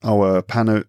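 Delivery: ordinary delivery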